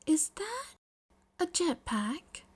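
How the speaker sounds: high then slowing disbelief; startled girl asking, slowing down; baffled. Acted. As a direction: stare at the jetpack in disbelief. Momo sees the jetpack.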